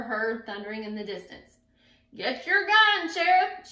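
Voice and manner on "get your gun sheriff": loud, high panicked shout. A narrator's reading. Frightened townsfolk shouting at the sheriff, panicked. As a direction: yell it as you run. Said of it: "shouted everyone".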